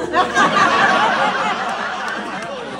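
loud high laugh; high burst of laughter. Real, not acted. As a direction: break into laughter. Laughs mid-story.

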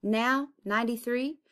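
plain reading voice

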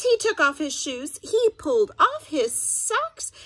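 lively read-aloud voice with sound effects; story narration. A narrator's reading. Teacher reading a picture book to her students, animated storytelling.

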